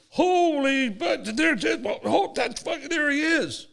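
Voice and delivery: squealing like starstruck fans; hammed-up excited gush. Players excited, grabbing the cup.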